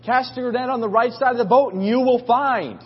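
high, carrying shout from shore; sustained high call; calling across water. Retold or imitated. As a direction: project it over the water as a retold hail. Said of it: "They're shouting back and forth".

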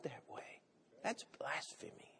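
hushed, grave whisper; whispered blasphemy verdict. Real, not acted. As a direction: breathe it out like a secret verdict. Whispered condemnation.

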